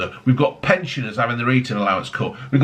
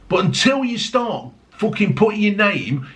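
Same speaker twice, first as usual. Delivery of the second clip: normal talking voice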